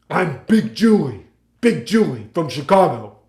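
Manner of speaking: slow, loud, projected character line; Big Julie stage voice. Retold or imitated. Friend on stage as Big Julie, loud slow delivery. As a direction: plant yourself and project each word slowly. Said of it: "really good as Big Julie".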